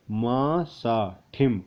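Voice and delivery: drawn out syllables; slow spelling out. Shown as a demonstration. Teacher sounding out a word slowly for students. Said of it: "मैंने आपको पढ़ाया".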